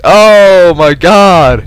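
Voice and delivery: loud, slow, stretched exclamation; shocked outcry. Real, not acted. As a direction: drag it out loud in disbelief. Sees the enemy team.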